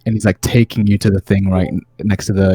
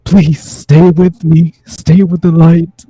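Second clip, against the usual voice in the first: pleading mother; 4 semitones higher, 6 dB louder than usual. Acted. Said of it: "the familiar voice of your mother saying".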